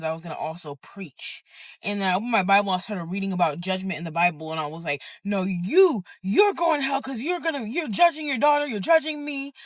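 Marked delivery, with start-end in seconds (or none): 5.2-9.5 high, pointed retort; heated accusation. Retold or imitated. Turning judgment back on the church lady, heated re-enacted accusation. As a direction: throw the blame back, pitched up.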